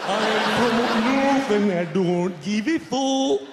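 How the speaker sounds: slow, loud mock Lancashire drawl; wrong-speed Lancastrian. Mimicking St Helens audience member, slow-record Lancastrian voice. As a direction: stretch every word like a record played too slow. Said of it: "sounds like you're not playing at the right speed".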